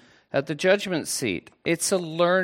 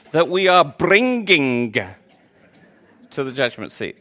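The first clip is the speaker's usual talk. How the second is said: exaggerated Birmingham accent; 5 dB louder than usual. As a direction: stretch it out in thick Brummie. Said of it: "your Birmingham accent isn't quite that strong".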